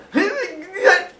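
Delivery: high awkward giggle; awkward laugh. Retold or imitated. Parents staring awkwardly.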